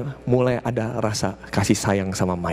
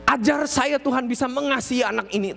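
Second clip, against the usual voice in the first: pleading prayer; 9 semitones higher than usual. Retold or imitated. Mother begging God to help her love this boy, pleading prayer. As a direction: cry out in prayer for help, pitched high. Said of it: "dia doa, dia bilang".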